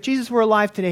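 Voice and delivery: normal talking voice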